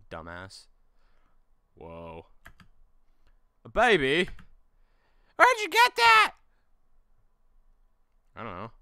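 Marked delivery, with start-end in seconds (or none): 3.6-6.3 very high, loud squeak; high squeaky friend. Acted. Friend shocked at the found baby, squeaky. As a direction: screech the question out.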